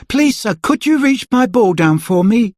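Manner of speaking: put-on high pleading child; a small boy asking for his ball; little boy voice. A narrator's reading. Boy asking Mister Stingy for his ball, pleading.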